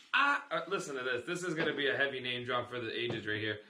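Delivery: ordinary talk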